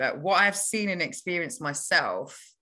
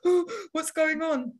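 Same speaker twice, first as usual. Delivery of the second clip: gasp, then a high, slow, frightened question; scared kids; 13 semitones higher than usual. Retold or imitated. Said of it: "And we're like".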